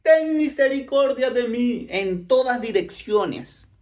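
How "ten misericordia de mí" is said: high, drawn-out cry for mercy; desperate beggar's full-voiced call; shouting. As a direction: shout it across a crowd. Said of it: "Y volvía a gritar".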